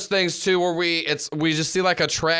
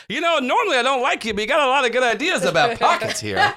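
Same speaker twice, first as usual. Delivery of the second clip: Brantley voice; 8 semitones higher than usual. Acted.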